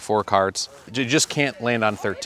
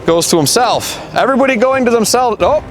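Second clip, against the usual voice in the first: live play-by-play; 4 semitones higher than usual. Acted. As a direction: call the race like a game-show host. Host calls the race live.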